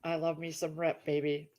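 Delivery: soft dreamy aside; dreamy murmur. Real, not acted. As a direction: sigh it like a private crush. Repeats gush over character.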